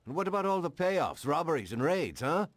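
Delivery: angry challenge. Acted. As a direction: throw the list in his face. Cop confronts mobster angrily.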